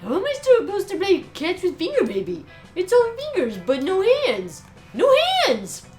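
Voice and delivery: rising high wailing despair; distraught wail. Acted. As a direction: voice climbs to a shriek. Character upset about no hands.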